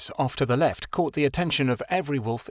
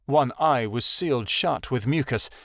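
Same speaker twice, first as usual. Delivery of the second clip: ordinary delivery, nothing marked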